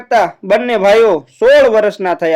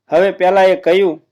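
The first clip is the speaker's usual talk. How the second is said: ordinary delivery, nothing marked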